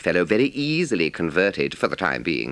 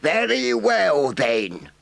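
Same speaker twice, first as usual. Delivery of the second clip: firm badger; 13 semitones higher, 4 dB louder, much slower than usual. A narrator's reading. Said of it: "said the badger firmly".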